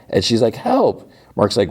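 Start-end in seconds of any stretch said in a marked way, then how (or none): 0.6-1.1 very high mock cry for help; retold yelp for help; calling out. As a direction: imitate a distressed call, pitched way up. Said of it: "call out".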